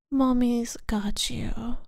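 slow, tender whisper. Acted. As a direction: whisper slowly to reassure. Soothing the listener.

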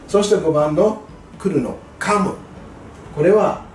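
usual speaking voice

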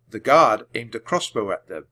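ordinary delivery

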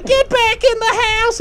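high-pitched mock yell; shrill-pitched order, hammed up; imitating Olive's yelling. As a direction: imitate a woman yelling everyone indoors. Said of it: "then there was Olive yelling".

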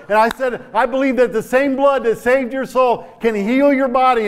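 high, loud fervent preaching; full-force preaching. Acted. Preacher urging the congregation, full force. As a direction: preach it to the back row.

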